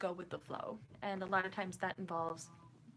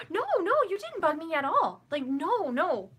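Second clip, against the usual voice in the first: high, loud, insistent "no, no"; emphatic reassurance; 7 semitones higher, 7 dB louder than usual. Real, not acted. Streamer answers a viewer's apology for bugging them, emphatic reassurance. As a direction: rush to reassure, pitched up and loud.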